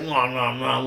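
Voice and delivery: imitated greedy chomping; playful nom-nom eating sounds; munching noises. Imitating kids snacking while fruit picking, munching noises.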